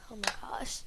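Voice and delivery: breathy whispered exasperation. Real, not acted. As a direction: whisper it to yourself.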